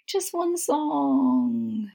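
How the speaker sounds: slow, drawn-out plea; pleading. Acted. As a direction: stretch each word out to coax her. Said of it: "he pleaded".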